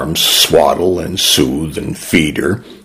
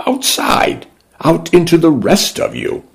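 put-on little girl voice; 11 semitones higher than usual. A narrator's reading. Young girl answers.

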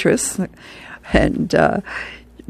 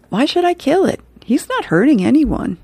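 put-on much higher, innocent little boy voice; little boy's thought; 9 semitones higher than usual. The grandson tells Grandpa his thought, in a high child voice. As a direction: wonder aloud like a kind small boy.

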